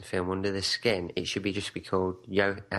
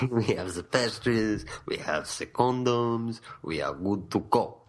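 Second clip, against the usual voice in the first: mock French accent. Co-host joining the French boasting, mock French accent. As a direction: list them off in hammed-up French. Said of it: "I'm French".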